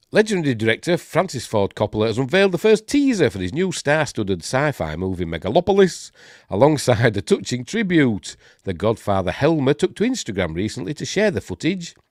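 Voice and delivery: article read-aloud. Acted. Host reading film news to viewers, lively read-aloud. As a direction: read it out like an entertainment bulletin.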